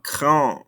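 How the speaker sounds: "grand" ending on the nasal vowel, no D; silent ending consonants. Shown as a demonstration. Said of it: "don't pronounce these ending consonants".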